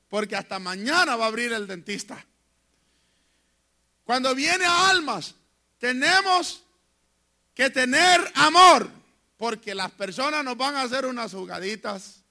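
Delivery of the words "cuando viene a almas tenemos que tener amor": loud, rising pulpit preaching with punched words; forceful sermon delivery, building to peaks; fiery preaching. Acted. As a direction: preach it hard to the congregation. Preaching climax.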